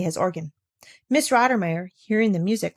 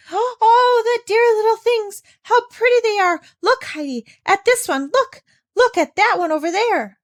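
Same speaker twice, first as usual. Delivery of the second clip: high, loud gushing delight; 13 semitones higher, 5 dB louder than usual. Acted. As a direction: squeal over the kittens. Clara delighted by kittens.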